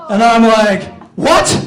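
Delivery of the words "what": high, loud, stunned yelp; acted disbelief bursting out; inner outburst. Rejected suitor screaming inside his head, loud disbelief. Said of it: "In my mind, I'm actually".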